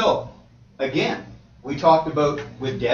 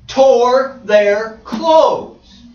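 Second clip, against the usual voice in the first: shout; 6 semitones higher, 9 dB louder, much slower than usual. Acted. Reading scripture, climactic shout.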